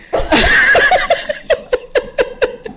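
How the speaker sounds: high airy laugh; wheezing laugh; breathy laugh. Real, not acted. Cracking up.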